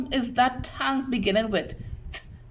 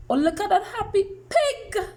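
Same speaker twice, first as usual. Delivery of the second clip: much higher, excited exclamation; playful delight; 10 semitones higher than usual. Acted. Teacher showing children a picture, high and delighted. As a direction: coo over it in a high, delighted pitch.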